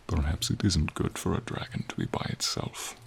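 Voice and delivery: ordinary talk, nothing marked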